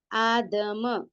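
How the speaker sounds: long aa in आदम, then separate da and ma; syllable-by-syllable reading. Shown as a demonstration. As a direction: draw out आदम sound by sound. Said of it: "ध्यान दे और आवाज देखे".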